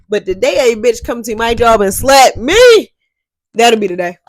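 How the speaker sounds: loud, defiant shout. Retold or imitated. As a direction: throw the dare out loud. Defiant Snapchat retort, retold.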